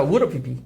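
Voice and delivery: "우르 피피" said low with would've as a quick ur; reduced would have. Shown as a demonstration. Teacher drilling students on reduced would have. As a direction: model "우르 피피" clipped and fast. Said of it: "해보자".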